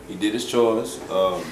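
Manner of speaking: ordinary delivery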